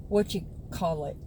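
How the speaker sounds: normal talking voice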